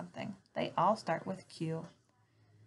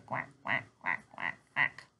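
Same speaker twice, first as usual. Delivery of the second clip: duck quack; 8 semitones lower than usual. Retold or imitated. Teacher imitates a duck.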